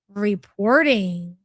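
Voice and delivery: ordinary delivery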